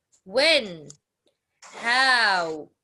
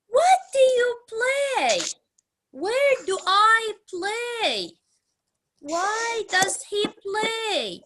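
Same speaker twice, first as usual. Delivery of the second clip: much higher, loud questions, falling at the end; questioning intonation; 9 semitones higher, 3 dB louder than usual. Shown as a demonstration. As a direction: ask each question high and clear, dropping at the end. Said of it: "Notice my intonation, how I express the way I am asking you".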